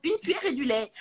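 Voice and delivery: a light imitation of a baby asking to be fed. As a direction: play the helpless baby. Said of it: "je suis un bébé".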